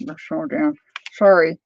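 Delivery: talking around a paintbrush; words muffled by a brush in mouth; mouth full. Real, not acted. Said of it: "I got a paintbrush in my mouth".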